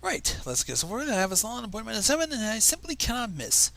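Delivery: lightly voiced prim pony, high and brisk; a hint of a prim, busy unicorn; Rarity voice. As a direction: play the fussy fashion pony in a hurry. Reading Rarity's lines in the fanfic.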